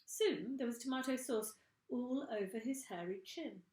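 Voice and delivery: ordinary delivery, nothing marked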